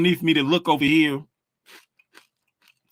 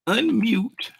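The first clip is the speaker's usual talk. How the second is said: Unmute said much higher, squeaked; high squeaky voice; 9 semitones higher than usual. Shown as a demonstration. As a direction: pitch Unmute way up. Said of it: "repeated in a high voice".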